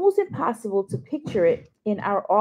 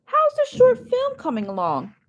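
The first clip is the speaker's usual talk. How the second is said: higher, brighter fake-cheerful question; forced optimism; 14 semitones higher than usual. Dad lifting his voice to change the subject, forced cheer. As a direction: lift the voice to force some cheer. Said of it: "he lifts his voice, forcing optimism".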